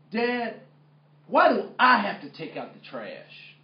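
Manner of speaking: loud, high-pitched complaint to dad; protesting teenager. Retold or imitated. Teenage son talks back to his dad, protesting. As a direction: imitate a kid talking back.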